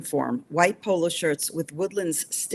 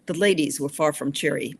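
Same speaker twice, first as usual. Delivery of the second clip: plain reading voice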